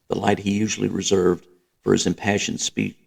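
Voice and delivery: usual speaking voice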